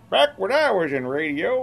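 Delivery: put-on slow, high old-timer; mock grizzled veteran. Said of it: "mock old-timer reminiscing".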